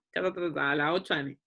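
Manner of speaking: low, hesitant patient voice; patient answering. Shown as a demonstration. Nutritionist voicing a patient recalling breakfast time, low and hesitant. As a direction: mumble it low while thinking.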